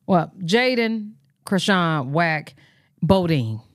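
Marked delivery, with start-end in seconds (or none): none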